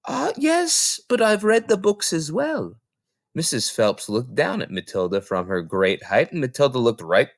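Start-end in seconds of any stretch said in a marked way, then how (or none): 0.1-2.8 put-on very high child's voice; little girl voice. Small girl telling the librarian she read the books, very high. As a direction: answer plainly like a tiny girl.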